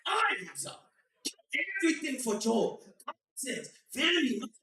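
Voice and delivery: high, loud, slow sermon voice in broken English; preachy parent storyteller. Retold or imitated. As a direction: preach the story of Job like a strict dad. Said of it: "he'd be like".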